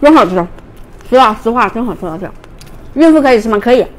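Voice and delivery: chewing while talking; speaking between bites; mouth full of corn. Real, not acted. Seen 1: Talks while biting and chewing corn on cob throughout.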